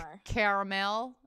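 caramel with three syllables, ending in mel; mock American caramel; imitating American caramel. She mimics how Americans say caramel. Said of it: "'cause they're like".

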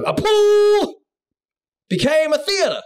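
high, slow amazed punchline; dramatic reveal. Acted. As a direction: stretch it out in wonder.

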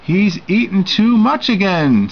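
host fed up with the glutton; complaining. A narrator's reading. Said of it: "Sedgwick complained".

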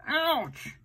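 high squeaky pained ouch; mock ouch. Uncle voicing the hurt dog, high playful ouch. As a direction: cry out like a hurt puppy. Monkey bumps his head.

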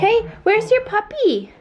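high, slow sweet voice. Real, not acted. Mom to toddler at bedtime.

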